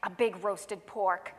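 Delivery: plain reading voice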